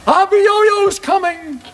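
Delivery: high-pitched, drawn-out alarm call; panicked warning cry. Acted. Villagers warn each other of the coming giant, high panicked cries.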